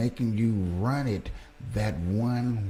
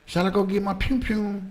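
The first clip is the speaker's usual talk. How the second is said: much higher and louder, teasing about fetching a gun; playful tease; 11 semitones higher, 4 dB louder than usual. Pretend. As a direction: ask it like a cheeky kid with pew pew.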